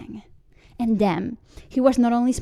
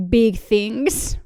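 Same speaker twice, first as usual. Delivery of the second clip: ordinary delivery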